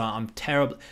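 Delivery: normal talking voice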